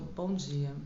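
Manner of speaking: a flat, sober greeting; serious good morning. Shown as a demonstration. Coach demonstrating a serious greeting, sober. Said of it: "bom dia mais sério".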